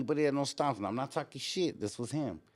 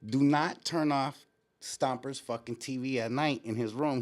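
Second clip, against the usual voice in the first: ordinary delivery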